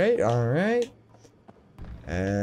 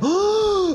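big high-pitched gasp; excited gasp. Real, not acted. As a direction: gasp sharply at a thrilling surprise. Mock excitement at a new challenge.

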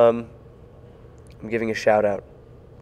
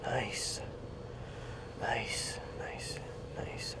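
soft breathy whisper; 19 dB quieter, much slower than usual. Real, not acted. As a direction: whisper it to yourself. Whispers reacting to announcement.

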